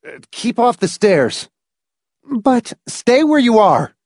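high, urgent command to stay back; urgent warning. A narrator's reading. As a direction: call up the stairs in alarm. Frantic warning to his wife.

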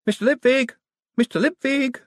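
high, urgent call for someone. A narrator's reading. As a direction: call his name out across a busy hall. Clerk calling out across the Mint.

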